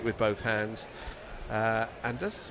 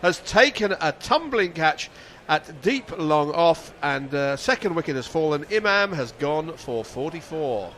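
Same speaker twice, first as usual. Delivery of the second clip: high, loud, slowed-down commentary call of the wicket; energetic radio commentary; live play-by-play; 5 semitones higher, 9 dB louder than usual. Real, not acted.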